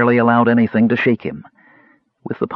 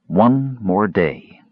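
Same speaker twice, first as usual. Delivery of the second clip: very slow, grave repetition; slow, ominous; much slower than usual. Acted. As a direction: drag out every word darkly. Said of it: "that we have one more day".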